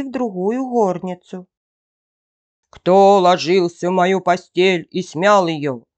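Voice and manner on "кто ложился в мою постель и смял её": loud big-bear roar; fearsome bear roar. A narrator's reading. Big bear finds his bed rumpled, fearsome roar. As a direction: roar it as the huge menacing bear. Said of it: "заревел Михаил Иваныч страшным голосом".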